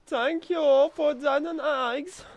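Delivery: high, slow mock German accent; exaggerated German accent, high and drawn out; German guy voice. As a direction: ham up a squeaky German character thanking you. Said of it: "I'm suddenly a German guy".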